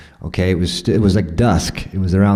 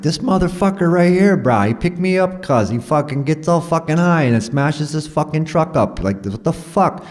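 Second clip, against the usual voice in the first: much higher pitched, heated cussing; retold furious rant; 10 semitones higher than usual. Telling the locals what the driver did, high heated rant. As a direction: rant it up high like you're still mad. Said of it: "totally cussing this dude out".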